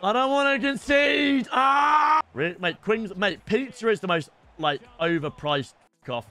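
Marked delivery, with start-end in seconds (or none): 0.0-2.2 panicked shout. Real, not acted. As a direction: yell as the defence breaks. Panicking about conceding.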